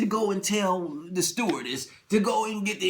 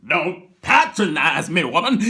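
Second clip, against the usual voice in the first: louder, slower rebuke; irritated snap; 2 semitones lower, 7 dB louder than usual. Retold or imitated. Husband snapping at his wife. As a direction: bite it off at her. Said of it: "husband snaps at wife".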